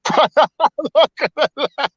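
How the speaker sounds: high-pitched, laughing through the words; real laughing. Real, not acted. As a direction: still cracking up while telling it. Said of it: "I was rolling on the floor".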